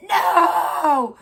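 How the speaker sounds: high, loud gasping shout of shock; shocked outcry. Retold or imitated. Fan shouting in shock at the name Chalfont. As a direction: cry out in sudden recognition.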